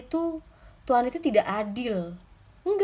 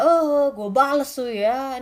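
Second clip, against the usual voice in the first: loud, drawn-out mock threat; mock vengeful outburst; 3 semitones higher, 5 dB louder, slower than usual. Preacher voicing what David did not say, mock vengeful. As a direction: act out a hammed-up vow of revenge.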